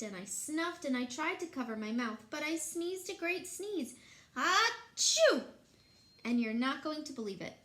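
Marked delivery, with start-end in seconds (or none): 2.3-3.9 building up to sneeze. A narrator's reading. 4.4-5.4 huge high fake sneeze; loud sneeze. Big sneeze.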